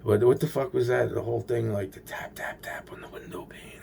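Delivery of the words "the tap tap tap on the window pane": spooky whisper of the tapping. Acted. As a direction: breathe the words like a ghost story. Eerie tapping at the window.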